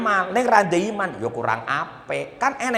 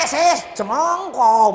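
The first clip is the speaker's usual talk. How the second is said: slow, higher, loud put-on voice; hammed-up quote; 8 semitones higher, 4 dB louder, much slower than usual. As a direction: drag it out teasingly. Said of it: "terus sampe tarik".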